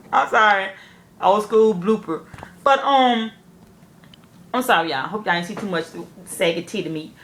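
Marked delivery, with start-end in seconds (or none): none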